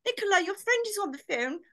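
high, singing grand-lady call; mock-posh mum. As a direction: summon her as if across a mansion. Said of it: "we didn't do posh".

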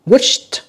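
"Wished" high, ending in a t sound; final T sound. Shown as a demonstration. Teacher showing students the ending, "Wished" with final /t/. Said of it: "âm tờ nha".

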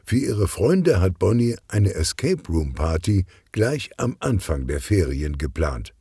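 plain reading voice

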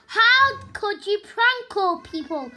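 ordinary talk, nothing marked

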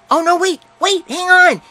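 excited. A narrator's reading. Missy excited, child voice.

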